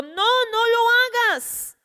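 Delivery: high, slow urgent cry; Paul crying out to the jailer not to harm himself; Paul shouting out. Acted. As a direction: call out to stop him in time. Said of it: "Pablo gritó a gran voz".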